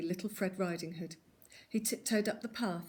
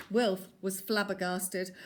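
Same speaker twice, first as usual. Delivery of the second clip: plain reading voice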